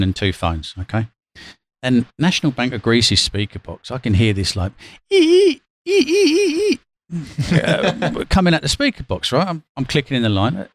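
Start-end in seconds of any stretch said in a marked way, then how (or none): 5.1-6.8 high piercing imitated squeal; shrill ee ee mouse squeaks; mock mouse squeak. Squealing noise from speaker box.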